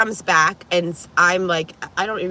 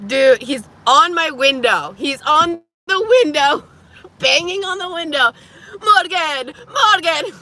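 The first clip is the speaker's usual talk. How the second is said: panicked retelling; 8 semitones higher, 5 dB louder than usual. Retold or imitated. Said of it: "I'm like".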